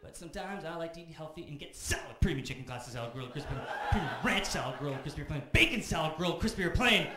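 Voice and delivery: building from list to loud, high rant; getting angry. Acted. Comic reciting the whole menu, growing loud and furious. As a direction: reel off the menu, rising into a yell. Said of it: "Just getting angry".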